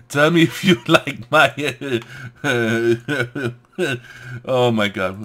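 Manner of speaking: words broken up by loud laughter; real loud giggling; laughing loudly. Real, not acted. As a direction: laugh through it, can barely get words out. Laughing asking about the impression.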